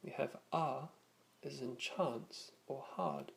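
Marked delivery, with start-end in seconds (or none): 1.8-3.3 British "chance or hard" with long ah, no r in "hard"; British style accent. Shown as a demonstration. Teacher recapping British style vowels, British long ah in "chance or hard". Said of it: "more British style usage".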